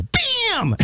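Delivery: high, hammed-up rapper shout; hype rapper shout. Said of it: "I need you to go".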